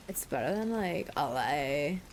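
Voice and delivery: "like, L A" stretched out very slowly; mock drawn-out. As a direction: drag out "L A" in a mock drawl. Mock repeat of the place name.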